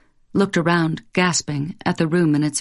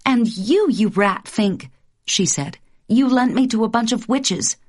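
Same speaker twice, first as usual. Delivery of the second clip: higher, playful scolding; higher mock outrage; mock accusation; 5 semitones higher than usual. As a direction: tease him with a fake charge. Wife playfully accuses husband.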